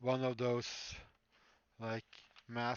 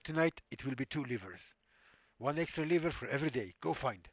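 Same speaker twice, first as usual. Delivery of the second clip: put-on louder menacing Russian accent villain; 5 dB louder than usual. Surgeon demands a liver from his patient, louder Russian accent. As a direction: bark orders like a Hollywood Russian bad guy. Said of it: "Still don't know why I do Russian".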